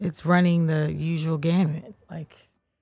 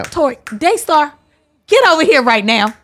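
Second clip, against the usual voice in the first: high, loud scolding call across the room; yelling child's full name; 9 semitones higher, 9 dB louder than usual. Pretend. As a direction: shout the kids' names like a fed-up daycare worker. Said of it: "It's like working at a daycare".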